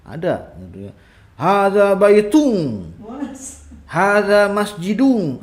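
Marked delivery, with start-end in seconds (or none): 1.4-2.9 Hadza baitun with baitun ending in ng, slow, louder and higher; mock Sulawesi-style baitung. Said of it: "orang Sulawesi ... seperti itu". 3.8-5.4 masjidung with a nasal ng ending, slow and louder; mock Sulawesi masjidung. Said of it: "ada engnya dia".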